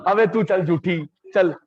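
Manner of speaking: hammed-up student sulk; mock sulky student. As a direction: play the huffy student brushing him off. Teacher mimics sulky students.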